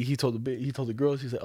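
ordinary talk

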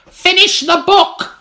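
high, slow, loud crone yell. Acted. Old woman orders William, high slow loud yell. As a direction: bellow it like a shrieking old hag. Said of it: "she yelled".

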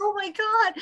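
high-pitched, mock-awed exclamation; retold amazement. Recalling her first sight of it to the interviewer, amazed. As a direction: relive the astonished reaction, pitch shooting up.

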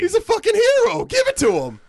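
high-pitched shouted plea; loud comic outrage. Acted. Friend championing the veteran as a hero, shouting in comic outrage. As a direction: shout it high, demanding on his behalf. Comic outburst defending the man.

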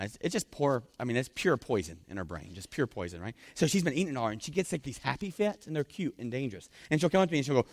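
normal talking voice

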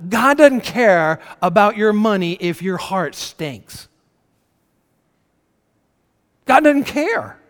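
loud emphatic preaching, punching doesn't; forceful preaching. Real, not acted. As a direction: drive it home from the pulpit. Preacher hammering the point.

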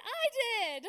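high, loud and beaming, imitating herself; eager confession of her good deed, retold; proud excited. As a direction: proudly announce she did it, thrilled. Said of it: "And I said".

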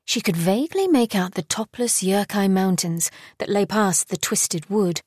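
usual speaking voice

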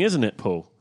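normal talking voice

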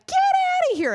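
very high, loud retold outburst; hammed-up high-pitched holler; mock incredulous yell. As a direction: yelp it in exaggerated disbelief. Mock disbelief at the dentist's story.

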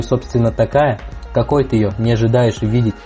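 normal talking voice

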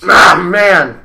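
loud, high exclamation; mock-swearing shout. Parodying curse words for co-hosts, mock-swearing yell. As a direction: yell it as if it were filthy.

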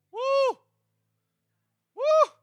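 high-pitched mock whoop; short, high imitation of a yelling crowd; imitated screaming. Churchgoers screaming at his mom and dad, imitated high whoops. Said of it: "pointing and yelling and screaming".

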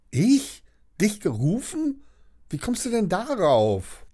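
put-on high-pitched, mildly incredulous girl. A narrator's reading. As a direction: throw the accusation back in disbelief. Girl startled by dragon's accusation. (hoch und ungläubig nachgefragt)

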